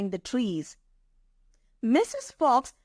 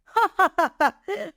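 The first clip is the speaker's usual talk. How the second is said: mocking lion laugh; 9 semitones higher than usual. Lion laughing at the mouse's offer to help, mocking.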